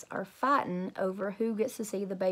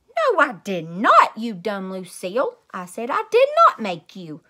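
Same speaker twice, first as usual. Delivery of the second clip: angry denial; 11 dB louder than usual. Acted. Junie B fighting with Lucille, an angry loud yell. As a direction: snap back furiously at Lucille.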